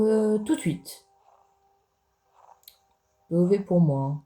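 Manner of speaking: ordinary talk, nothing marked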